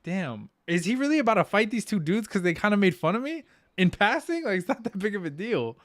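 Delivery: retold disbelief, pitched well up; incredulous. Retells disbelief at friend's overreaction.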